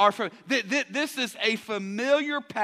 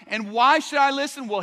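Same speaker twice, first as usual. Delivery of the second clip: usual speaking voice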